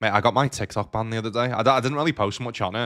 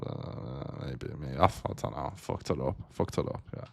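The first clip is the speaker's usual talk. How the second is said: much softer, lower, partly whispered self-talk; muttered regret; 8 semitones lower, 9 dB quieter than usual. Real, not acted. Streamer realizes he misplayed, quiet muttering. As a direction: mutter to yourself after a mistake.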